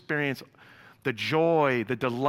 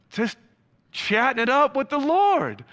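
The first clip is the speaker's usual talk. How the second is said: excited; 11 semitones higher, 6 dB louder than usual. Real, not acted. Excited picture of heavenly fellowship.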